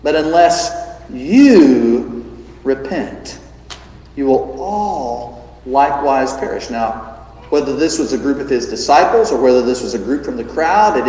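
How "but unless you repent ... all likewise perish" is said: "you repent" drawn out very slowly, "all" weighted; slow down and lean on "you" and "all"; grave slow warning. Retold or imitated. Preacher voicing Jesus' solemn warning to the crowd, slow. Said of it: "And Jesus says".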